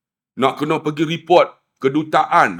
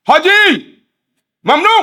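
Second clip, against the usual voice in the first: a retold policeman's high shout; mock shout; 14 semitones higher, 7 dB louder than usual. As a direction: yell it high and loud like a guard stopping someone.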